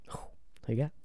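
hushed, secretive whisper. Acted. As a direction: lean in and whisper it. Teasing whisper asking for picks.

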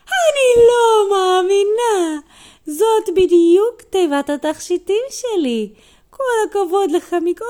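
high, loud tearful-sounding wail; overwhelmed grandma. Acted. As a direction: cry it out hammed-up, long stretched words.

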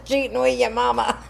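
laughing. Real, not acted. Friend laughing at joke.

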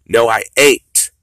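loud, slow defiance; a loud, drawn-out no; defiant shout. Real, not acted. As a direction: shout the refusal. Defiant rant.

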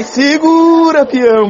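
high, stretched-out shout of hold on; excited yell. Real, not acted. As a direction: cry out, hanging on the moment. Tense overtake, urging driver.

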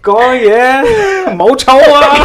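high, loud Cantonese through laughter; giggly mock Cantonese phrase; laughing Cantonese. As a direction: laugh while showing off Cantonese.

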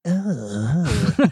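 lightly voiced horror movie killer; a hint of the Scream caller; Ghostface voice. Shown as a demonstration. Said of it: "I'm trying to do the Ghostface voice".

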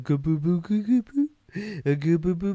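high, cooing baby talk, mocking. Wrestler mocked cooing at a box, in baby talk. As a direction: coo nonsense like talking to a baby. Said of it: "is baby talking to a fucking box".

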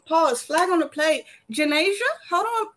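high, loud mock concern; worried parent. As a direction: check on her like a fussing parent.

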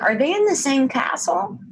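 high-pitched mock bewilderment; baffled question. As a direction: voice climbing high in retold disbelief. Retold baffled reaction to the film.

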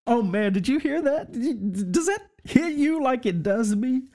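can barely contain the joy; thrilled preacher gushing to listeners; real excitement. Real, not acted. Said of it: "I wanna jump up and run around".

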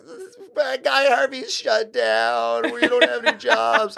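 hammed-up sobbing shout; mock bawling. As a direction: imitate a manager crying down the phone. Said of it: "bawling her eyes out".

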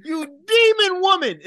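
hammed-up heartbroken pirate; outraged Jack. Jack mourning the burnt liquor to Elizabeth, mock wailing. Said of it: "He's like".

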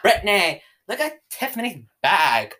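envy it in mock Valley girl; Valley girl accent. Girl acting jealous of Tiffany's bag, Valley girl accent. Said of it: "that's the Valley girl accent".

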